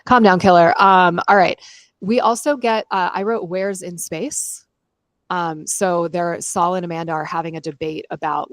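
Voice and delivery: ordinary talk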